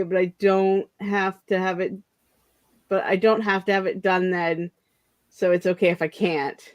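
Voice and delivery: ordinary delivery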